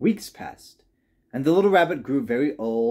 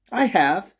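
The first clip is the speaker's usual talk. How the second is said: high, louder protest from a little rabbit; crying out; 8 semitones higher, 6 dB louder than usual. A narrator's reading. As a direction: cry it out indignantly, pitched up. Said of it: "cried the little rabbit".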